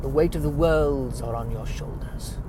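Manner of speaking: lightly voiced solemn Stewart narration; Patrick Stewart. Stewart-style trailer voice-over for John Carter of Mars, understated. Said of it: "So Patrick Stewart and John Carter of Mars".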